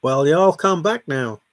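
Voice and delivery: slow, hammed-up American Southern drawl; attempted American accent. Shown as a demonstration. Brit put on the spot trying an American accent, hammed up. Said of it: "Let's hear your best American accent".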